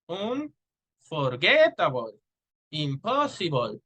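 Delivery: slow "Unforgettable, impossible", stressing GET and POS; word stress demo. Shown as a demonstration. Teacher reading stress examples to learners, slow and exaggerated stress. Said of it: "Some more examples would be".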